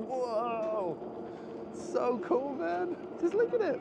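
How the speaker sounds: high, thrilled gushing; excited awe. Retold or imitated. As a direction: gush like a wide-eyed tourist. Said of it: "I'm looking around thinking".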